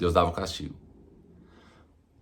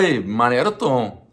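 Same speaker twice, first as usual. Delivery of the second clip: mildly hammed-up rebuke, raised pitch and volume; scolding church; 6 semitones higher, 5 dB louder than usual. Church telling the president to stop swearing, mock scolding. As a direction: tell him off, higher and louder, in insistent bursts. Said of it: "A igreja era pra falar".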